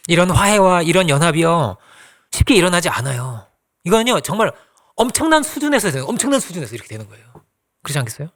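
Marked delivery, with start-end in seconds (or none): none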